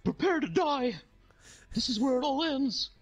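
high, hammed-up villain threat; Ronald McDonald. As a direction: declare doom as a manic clown. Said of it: "It's like".

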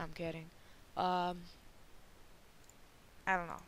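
ordinary talk

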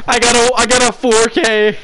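high, loud triumphant shriek; shrieking jackpot winner. Real, not acted. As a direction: shriek it like you won the jackpot. Bragging after a big round.

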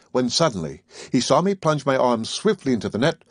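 ordinary delivery

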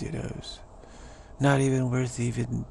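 ordinary delivery

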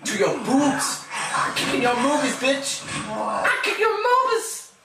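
high, excited battle taunts; gleeful taunting. Real, not acted. Player taunting the zombie being kicked, high gleeful yelling.

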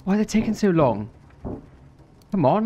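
ordinary talk, nothing marked

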